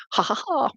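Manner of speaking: brief "ha ha ha" chuckle; short pleased laugh. Real, not acted. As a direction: laugh lightly in three beats. Laughs after viewer confirms house move.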